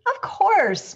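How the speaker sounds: loud, high impatient reply; growing impatience. A narrator's reading. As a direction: answer as if it were obvious. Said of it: "replied with growing impatience".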